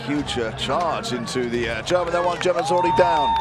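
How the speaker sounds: loud, excited fight commentary; live play-by-play. Real, not acted. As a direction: call the action as it happens.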